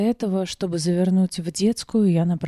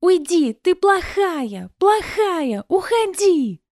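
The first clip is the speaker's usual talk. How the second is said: put-on high, loud child's shriek; a little girl screams in anger; child screams at mother to go away; 12 semitones higher, 5 dB louder, slower than usual. A narrator's reading. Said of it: "Эмми завизжала".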